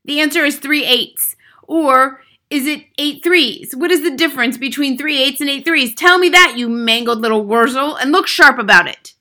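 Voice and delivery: high, loud raging rant; furious, bullying headmistress; yelled tirade. A narrator's reading. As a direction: berate the boy mercilessly. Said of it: "yelled the Trunchbull".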